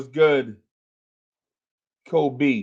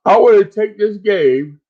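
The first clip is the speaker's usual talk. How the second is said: higher, louder sudden burst; loud outburst; 8 semitones higher, 6 dB louder than usual. Real, not acted. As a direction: blurt it out loud. Outburst before apology.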